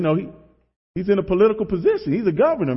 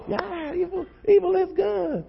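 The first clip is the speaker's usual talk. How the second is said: very high, mock excited crowd voice; riled-up congregation; 3 dB quieter than usual. Preacher imitates the movie audience echoing back, riled up. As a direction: echo it far up high like a worked-up church crowd. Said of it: "the audience is so riled up".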